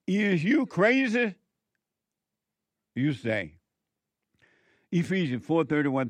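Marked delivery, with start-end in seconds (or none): 0.1-1.3 high, loud, slow scolding question; incredulous shout. Real, not acted. Preacher to congregation who won't let anger go, slow shout. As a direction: throw it at them in disbelief.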